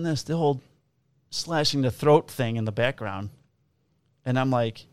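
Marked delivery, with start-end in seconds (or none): none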